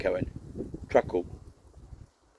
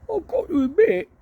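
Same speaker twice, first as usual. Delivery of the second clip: much higher rueful barbarian; Big Willie voiced. Big Willie rubbing his thigh, high rueful grumble. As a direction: play the wounded old fighter.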